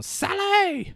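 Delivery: loud, high call across the party. Retold or imitated. Rolf searching the party for Sally, loud high call. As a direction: yell the name out.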